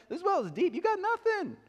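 very high, sugary southern lilt; sweet southern accent. Acted. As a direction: coo it in a playful southern accent. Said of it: "being sweet and gentle to him".

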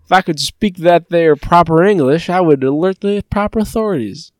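put-on cartoon dog voice; talking dog. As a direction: play a homespun dog detective building to alarm. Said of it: "and the dog's like".

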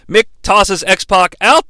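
normal talking voice

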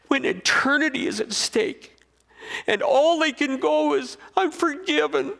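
high, loud, passionate preaching; full-force preaching. Acted. Preacher building to a loud, high peak. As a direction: preach it out to the crowd, climbing.

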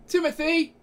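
loud high call across the room. Acted. NPC calls out a name.

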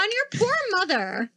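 high, drawn-out scolding echo; retold outrage in a high voice; incredulous indignation. Indignant retort, continued after interruption.

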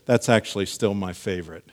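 ordinary delivery

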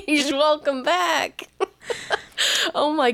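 giggling through words; laughing. Real, not acted.

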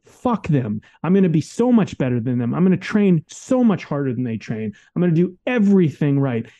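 rally yourself like a competitor before a game; the champion's attitude, defiant and driven. Retold or imitated. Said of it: "is you go".

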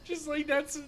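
high, squealing laughing speech; helpless laughter. Real, not acted. Co-host cracks up at the podcast name, high and breathless with laughing. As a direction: squeeze the words out through laughter.

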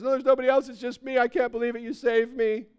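high, loud, breathless joy at being rescued; overjoyed castaway. Acted. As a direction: gush it out like a man just saved.

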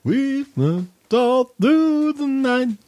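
high, slow mock lament; high drawn-out whine. Mock dramatic claim.

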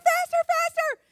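put-on high, loud thrilled kid chant; child in back seat urging daddy on, shrieking; gleeful child. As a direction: squeal it like a delighted small girl. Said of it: "And so I'll be like".

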